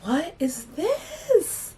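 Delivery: slow, drawn-out high disbelief; mock confused squeal; baffled. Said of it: "I was like".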